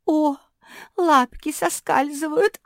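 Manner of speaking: high, loud, slow wail; panicked cry. A narrator's reading. Марсия as her paws slip, panicked high cry. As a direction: cry out while losing your grip. Dog slipping from the branch.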